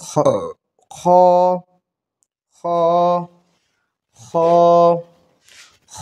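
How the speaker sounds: খ rasped from the back of the throat; throaty খ. Shown as a demonstration. Teacher modelling খ from the throat for students. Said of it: "হলকের শেষ হইতে গইন খ উচ্চারিত হয়".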